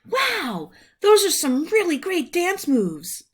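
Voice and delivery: gushing with delight over the moves; impressed admirer voice. Acted. An imagined kid praises Rumpel's dancing, high and gushing. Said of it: "Rumpel imagining".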